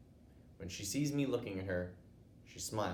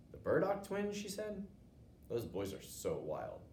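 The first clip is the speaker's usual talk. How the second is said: high then slow disapproval; a mom tutting at wild boys; disapproving mom; 4 semitones higher than usual. Acted.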